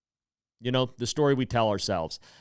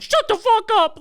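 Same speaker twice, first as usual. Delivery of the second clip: sudden high shrieking yell, much louder; retold furious yell; 8 dB louder than usual. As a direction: snap back at the friend in a burst of outrage. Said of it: "And I'm like".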